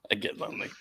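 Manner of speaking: breathy whisper, teasing; whispered mock lonely. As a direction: whisper it back mockingly. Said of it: "laughing repeat of the line".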